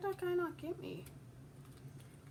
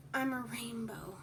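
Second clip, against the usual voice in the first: whisper; 3 semitones lower than usual. Pretend.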